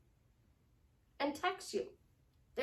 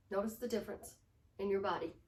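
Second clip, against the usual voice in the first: ordinary delivery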